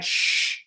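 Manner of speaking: sharp unvoiced shush. Cinemagoers behind shushing his dad, annoyed hush. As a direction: hush a noisy person. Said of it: "The guys behind are like".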